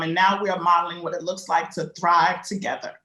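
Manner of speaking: normal talking voice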